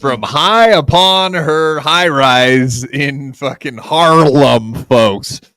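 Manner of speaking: loud, slow mock proclamation; mock grandiose. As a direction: boom it out like a herald. Mocking her lofty tone.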